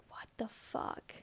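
unvoiced stunned whisper; whispered disbelief. Best friend reacting by text, whispered disbelief. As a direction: breathe it out in disbelief.

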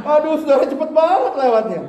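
laughing exclaim. Real, not acted. Laughing at the fast car.

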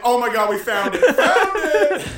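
high, loud, laughing cheer; mock triumph, laughing; excited cheer. Group cheers finding the nickname.

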